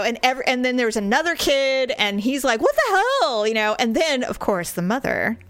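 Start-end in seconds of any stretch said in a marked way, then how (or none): 2.6-3.4 high-pitched imitation of an appalled kid; mock outrage. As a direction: exclaim it like an indignant bystander. Another kid scolds the driver.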